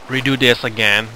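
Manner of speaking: ordinary talk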